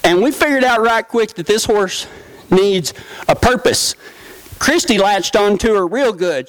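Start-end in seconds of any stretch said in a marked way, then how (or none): none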